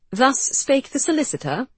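high, playful mock-solemn tease; teasing. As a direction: tease him, pitch way up. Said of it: "Georgie teased".

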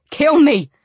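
high, loud desperate shout. A narrator's reading. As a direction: shout the plea much harder. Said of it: "he said again louder".

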